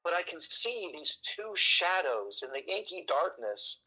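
ordinary delivery

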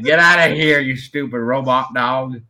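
mock-angry southern holler, slowing at the end; play-indignant southerner; southern accent. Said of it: "you get a southern accent".

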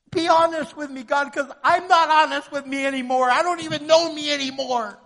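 high, loud pleading with God; impassioned prayer. Acted. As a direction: cry out the prayer at full force. Acted urgent prayer to God.

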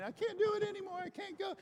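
high, strained, tearful voice; crying. Retold or imitated. Exhausted player cries to the coach, high and tearful. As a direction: break down as you say it, imitating a sob. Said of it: "He's crying".

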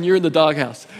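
ordinary talk, nothing marked

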